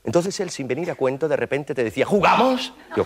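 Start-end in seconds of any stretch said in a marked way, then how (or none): 2.0-2.7 high teasing ask; mock eager invite. Coworker suddenly asking to play, mock eager. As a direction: pop the question up high like an eager pest.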